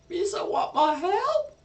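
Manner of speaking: high squeaky creature voice, touched; teary kobold. Acted. Meepo asking the players whether they want his help, high and teary. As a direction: squeak it out, moved to tears. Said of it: "with a little tear in his eye, he looks at you and goes".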